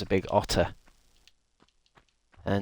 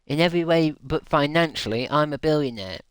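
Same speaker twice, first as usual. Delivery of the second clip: mock boastful voice; 4 semitones higher, 4 dB louder than usual. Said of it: "someone cringe being a wolf".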